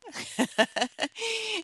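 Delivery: high, soft giggle. Real, not acted. Storyteller after her skydiver joke, real high giggle. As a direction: giggle at your own punchline. Laughs at her own punchline.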